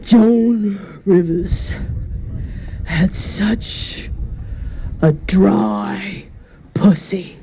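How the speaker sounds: slow, halting, tearful eulogy voice with catches between words; Howard Stern choking back tears. Retold or imitated. Howard Stern at Joan Rivers' funeral, choking back tears. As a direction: do a low, choked-up Howard Stern fighting back sobs. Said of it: "he's choking back tears".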